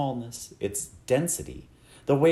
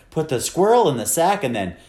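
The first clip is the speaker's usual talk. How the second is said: loud, impatient command; fed up and emphatic; exasperated order; 2 semitones higher, 7 dB louder, faster than usual. A narrator's reading. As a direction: lay down the order forcefully. Exasperated mother ordering her husband.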